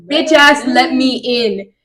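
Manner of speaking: loud mock shout at a door; rowdy yelling. Rowdy friends demand entry at the townhouse, mock yelling. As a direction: call out loudly, stretching the last words. Two dudes banging on the door.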